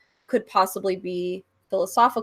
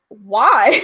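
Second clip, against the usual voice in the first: a laughing, louder why; laughing incredulous why; 5 semitones higher, 9 dB louder than usual. Retold or imitated. To people who called her an idiot, laughing why. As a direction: scoff at them through a laugh.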